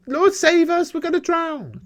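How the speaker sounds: high, loud frightened cry for help; panicked plea. Acted. Disciple crying out to sleeping Jesus, high and panicked. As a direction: beg to be saved as the boat sinks. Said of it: "and you are petrified".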